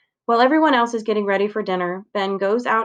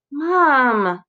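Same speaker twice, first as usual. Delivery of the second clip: higher and louder plea to mom; pleading; 4 semitones higher, 4 dB louder than usual. A narrator's reading. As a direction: beg mom for more time. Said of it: "he pleads".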